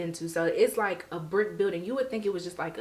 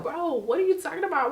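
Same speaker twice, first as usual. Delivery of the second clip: higher, incredulous friend voice; baffled friend; 8 semitones higher, 3 dB louder than usual. Retold or imitated. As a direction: ask it like you can't believe your ears. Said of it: "They like".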